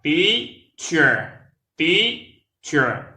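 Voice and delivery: Teacher said high and loud, TEA stressed, clear ch; two syllables TEA-cher; model pronunciation. Shown as a demonstration. Teacher modelling Teacher for learners. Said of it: "Try, essayez".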